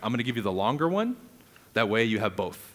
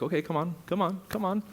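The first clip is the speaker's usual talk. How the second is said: much higher, light coaxing call; coaxing lead; 8 semitones higher than usual. Retold or imitated. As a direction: lead them along like leading a horse to water. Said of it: "requires you to be like".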